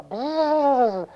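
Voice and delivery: high, sustained buzz; bee buzzing. Acted. Storyteller plays bees buzzing after the bear, buzzing. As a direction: buzz like a chasing swarm. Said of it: "buzzing after him".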